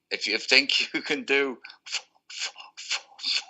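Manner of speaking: laughing imitation of a stammer, stuck hard on the F; Bernie, imitated stammer. Producer challenging the singer to do better, mock stammer. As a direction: draw it out, stutter repeatedly on the swear word, then burst through. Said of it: "he stammers a bit as well".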